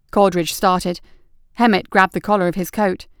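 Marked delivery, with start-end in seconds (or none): none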